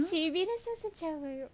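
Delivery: high, loud playful echo of her line; mimicked seductive lady. Echoes the game character's voice line.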